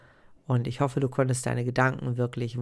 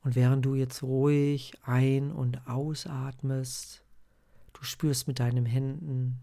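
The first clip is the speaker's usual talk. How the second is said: guided meditation. Acted. Leads a breathing meditation.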